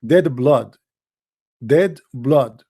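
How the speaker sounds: ordinary delivery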